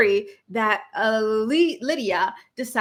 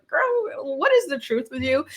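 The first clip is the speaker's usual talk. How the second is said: sassy; 5 semitones higher than usual. Retold or imitated. Vlogger teasing a cast member about her life, sassy and high. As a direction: tease her with a swooping "Girl".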